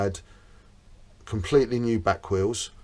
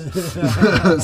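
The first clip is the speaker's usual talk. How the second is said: laughing; 7 semitones higher, 9 dB louder than usual. Real, not acted. Laughs after the Yoda voice.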